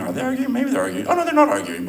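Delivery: very high mock-wondering voice; puzzled onlooker. Onlooker unsure if a noisy family is arguing, high-pitched mock puzzlement. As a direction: squeak it out like a baffled bystander.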